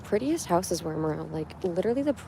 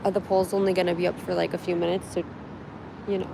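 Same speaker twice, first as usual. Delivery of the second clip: ordinary delivery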